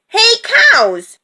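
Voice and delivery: high, loud rooster call; shouted high and loud; rooster's shout. A narrator's reading. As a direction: holler for attention as a bossy rooster. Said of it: "shouted the rooster".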